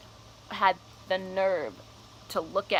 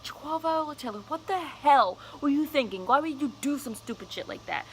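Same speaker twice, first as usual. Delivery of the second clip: imitating a furious friend, pitched way up; scolding friend; 7 semitones higher than usual. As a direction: play the friend chewing her out, high and sharp. Friend voiced scolding her.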